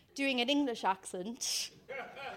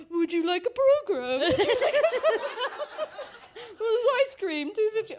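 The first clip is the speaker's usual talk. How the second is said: sheepish usher; 8 semitones higher, 5 dB louder than usual. Retold or imitated. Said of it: "I'm like".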